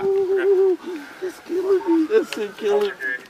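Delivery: groaning in pain. Real, not acted. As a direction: drag the words out through real pain. Wounded man groaning in pain.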